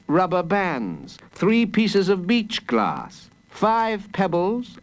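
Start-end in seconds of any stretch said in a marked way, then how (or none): none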